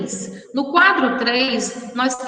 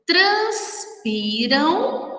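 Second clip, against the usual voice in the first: "transpiram" very slow, split into syllables; 3 semitones higher, much slower than usual. Shown as a demonstration. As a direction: stretch out each syllable of "transpiram". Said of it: "na separação de sílabas".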